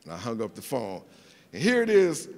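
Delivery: usual speaking voice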